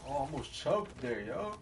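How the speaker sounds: usual speaking voice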